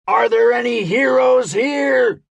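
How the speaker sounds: high, loud rallying scream. A narrator's reading. Gabe calling for heroes among thousands, screaming. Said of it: "he screamed".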